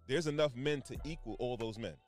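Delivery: usual speaking voice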